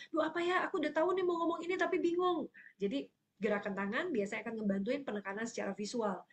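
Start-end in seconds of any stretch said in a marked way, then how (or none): 0.1-2.5 higher-pitched, quick fluster; mock flustered search for words; blanking, confused. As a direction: act out a speaker whose mind has gone blank. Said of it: "Waktu dia ngeblank".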